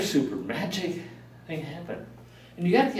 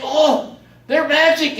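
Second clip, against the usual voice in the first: excited; 12 semitones higher, 7 dB louder, slower than usual. Retold or imitated. Lady excited about snakes.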